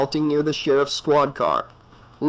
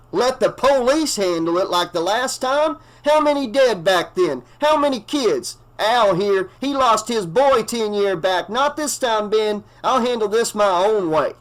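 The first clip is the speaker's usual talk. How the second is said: much higher, heated shouting; yelling back; 7 semitones higher than usual. A narrator's reading. Earl yells back at the sheriff about the last time. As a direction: throw the past in his face. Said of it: "he yelled back to the sheriff".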